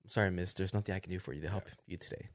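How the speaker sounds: low, soft polite refusal; imitating a subdued, courteous salesman; mock polite apology. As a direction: recite a courteous brush-off quietly and low. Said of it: "you were like".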